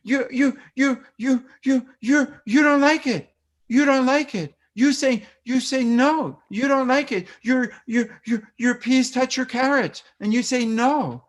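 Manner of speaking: put-on high, loud, repetitive baby talk mirroring upset; match a third of the toddler's meltdown in short phrases; toddlerese. Shown as a demonstration. Parent mirrors toddler upset about peas and carrots, high and loud. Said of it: "for an eighteen-month-old or a two-year-old".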